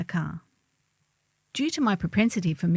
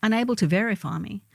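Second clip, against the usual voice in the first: ordinary talk, nothing marked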